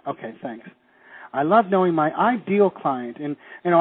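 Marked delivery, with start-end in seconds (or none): 1.3-3.2 overstress "ideal" for teaching purposes; extra emphasis on ideal. Shown as a demonstration. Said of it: "put extra emphasis on it".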